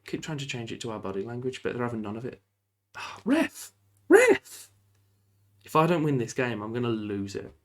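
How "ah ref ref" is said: high, loud outraged yell; shouting at the ref. Real, not acted. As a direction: bellow at the referee in protest. Yelling at the referee in game.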